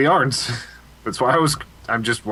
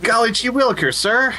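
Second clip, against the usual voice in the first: high, eager fifties newsboy; fifties paper boy; 7 semitones higher than usual. Acted. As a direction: chirp it like a wholesome paper boy. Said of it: "fifties paper boy".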